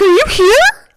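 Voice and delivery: high, loud frantic question; retold panic. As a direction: act out a panicked call to mom. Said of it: "I'm freaking out".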